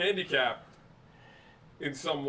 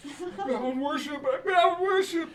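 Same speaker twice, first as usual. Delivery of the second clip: high, slow, put-on squeaky voice; mock high voice; 11 semitones higher, slower than usual. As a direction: squeak it out, laughing. Mock joke voice.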